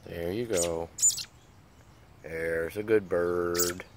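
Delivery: soft, slow, low praise for a pet; tender praise. Real, not acted. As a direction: gently praise the little bird. Soothing the bird while it drinks.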